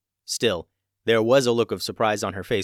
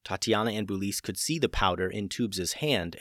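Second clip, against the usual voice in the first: usual speaking voice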